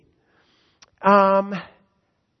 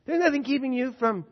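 loud, high, ringing sermon voice; full-force preaching; 4 semitones higher, 12 dB louder than usual. Acted. Preacher proclaiming scripture to the congregation, full force. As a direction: proclaim it to the whole hall.

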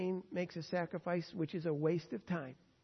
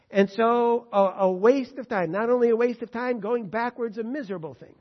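full-force preaching; 6 semitones higher, 13 dB louder than usual. Real, not acted. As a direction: drive the lesson home to the congregation.

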